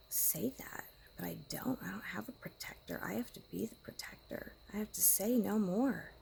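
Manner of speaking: hushed whisper. Real, not acted. Woman confiding about her angry partner in a whisper. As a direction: keep it to a secret whisper.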